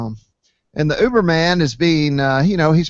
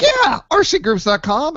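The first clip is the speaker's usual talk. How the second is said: much higher, eager confirmation; excited yes; 11 semitones higher, 3 dB louder than usual. Retold or imitated. To the Uber driver, thrilled high-pitched yes.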